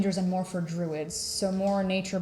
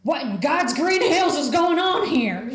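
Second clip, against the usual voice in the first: higher, loud bellowing dwarf commander; gruff dwarf; 7 semitones higher, 8 dB louder than usual. Acted. Dwarf commander interrupting the spell, loud gruff bellow. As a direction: bark it like an old commander. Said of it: "gruff squat dwarf".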